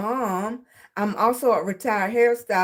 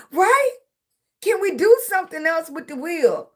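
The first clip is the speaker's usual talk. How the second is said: higher-pitched, insistent pleading questions; playful protest; 6 semitones higher than usual. Retold or imitated. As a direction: plead the case in a raised, animated voice. Acting out her plea to teachers.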